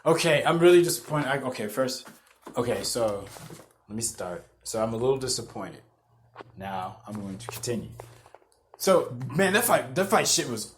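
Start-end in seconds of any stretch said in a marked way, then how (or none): 1.3-7.9 quiet and low, reining it in; soft, lower self-reset; composing himself. Real, not acted. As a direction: settle down after the excitement. Calming down, lowering voice.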